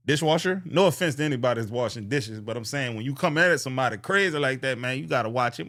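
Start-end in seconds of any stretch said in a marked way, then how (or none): none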